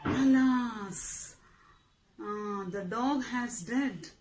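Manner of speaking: slow, soft, low lament; acted sorrow. Shown as a demonstration. Teacher giving students an example of exclamation, mournful. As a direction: sigh it out sadly, drawn out and hushed.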